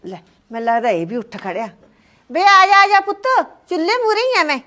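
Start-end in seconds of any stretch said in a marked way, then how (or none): 2.3-4.6 high, loud call to the son in the next room. Acted. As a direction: call him over from the stove. Old mother calls to her son. (ਉੱਚੀ ਆਵਾਜ਼ ਵਿੱਚ ਪੁੱਤ ਨੂੰ ਆਵਾਜ਼ ਮਾਰਨਾ)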